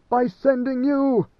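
high, slow, pained accusation; anguished. A narrator's reading. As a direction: wring the words out in anguish. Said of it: "he moaned in despair".

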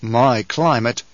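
slow, musing "My climate."; the Duke muses on Kynes's words; slow thought. Acted. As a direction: turn "My climate." over slowly in your head. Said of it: "And the Duke thought".

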